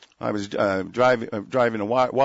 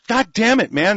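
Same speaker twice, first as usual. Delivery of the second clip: angry outburst; 6 semitones higher, 3 dB louder than usual. Real, not acted. Outrage over the torture report.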